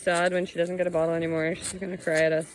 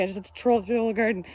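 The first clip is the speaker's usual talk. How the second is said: ordinary talk, nothing marked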